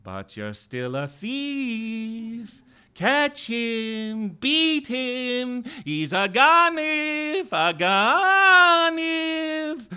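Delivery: high, drawn-out accusing call; eerie high wail of accusation; distant voice. A narrator's reading. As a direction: chant it slowly from far away, stretching the last words. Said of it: "I heard a distant voice saying".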